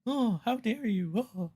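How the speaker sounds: high, pearl-clutching mock outrage; mock offended gasp. Mocking flexers who get offended, in a high mock voice. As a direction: toss it off like a scandalized diva. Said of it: "always ends up being like".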